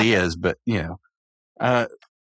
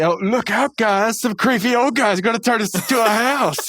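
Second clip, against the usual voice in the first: high, hammed-up scoffing warning; mocking Muscle Man; 9 semitones higher than usual. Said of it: "Muscle Man, he".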